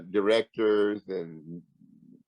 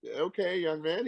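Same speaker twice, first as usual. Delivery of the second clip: slow, higher okay, lightly put-on older boss voice; imitating the boss; 8 semitones higher, slower than usual. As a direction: stall slowly like a boss covering that he forgot. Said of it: "He goes".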